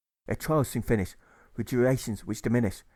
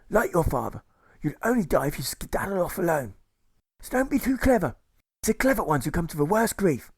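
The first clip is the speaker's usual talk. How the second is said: pompous colonel, pitched up; a lightly raised blustering officer voice; 7 semitones higher than usual. Acted. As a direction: play the rambling, self-important colonel. Colonel lectures the prisoner.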